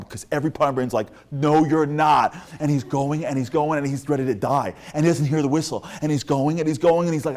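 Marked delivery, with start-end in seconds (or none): 1.3-2.4 loud, raised, drawn-out contradiction; defiant refusal. Retold or imitated. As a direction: shout back slowly, word by word. Brain refuses the command.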